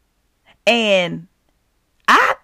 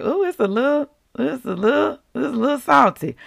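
higher, hesitant ooh, a little salty; wincing tolerance; 4 semitones higher, faster than usual. Retold or imitated. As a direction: politely put up with the taste. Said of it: "tolerate the salt to be like".

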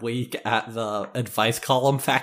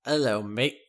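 put-on Australian accent. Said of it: "talk like an Australian person".

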